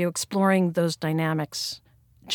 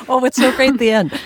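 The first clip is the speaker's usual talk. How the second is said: excited; 7 semitones higher, 7 dB louder, faster than usual. Real, not acted. Delighted reaction after reading.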